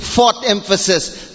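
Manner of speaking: ordinary talk, nothing marked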